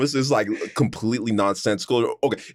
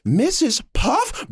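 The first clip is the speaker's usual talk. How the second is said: high, slow stretched shocked question; shocked SpongeBob; 12 semitones higher, much slower than usual. Retold or imitated. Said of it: "He's like".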